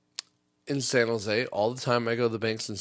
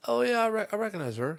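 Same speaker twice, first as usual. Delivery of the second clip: higher, lightly put-on lady teller voice; mock teller; 10 semitones higher than usual. Teller greeting a familiar customer, higher mock voice. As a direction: chirp it like a friendly teller.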